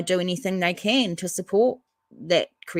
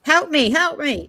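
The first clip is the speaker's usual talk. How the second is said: high, hammed-up cry for help; mock plea for help; 10 semitones higher, 4 dB louder than usual. Host left alone on the panel, pleading to the guests in a high mock cry. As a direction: playfully beg to be rescued.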